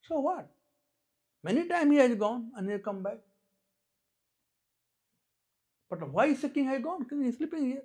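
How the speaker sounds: put-on high, loud child arguing back; small boy objecting. Small boy challenges news of the king's going, high and loud. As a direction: argue it high and insistent. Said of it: "Small boy, he said something".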